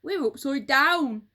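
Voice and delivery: a touch of panic, breathless; alarmed Mister Twit. A narrator's reading. Mister Twit realising they are upside down, loud alarm. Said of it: "gasped Mister Twit".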